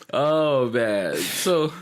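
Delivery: usual speaking voice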